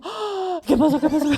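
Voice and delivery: grito de alarma, agudo y fuerte; amígdala alarmada. Pretend. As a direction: entra en pánico de broma. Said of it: "Muy buena interpretación". (amígdala asustada gritando alarmada)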